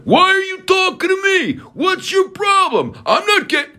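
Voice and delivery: mock shrill shout. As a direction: screech it like a hysterical suspect. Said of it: "Here's what I'm not doing".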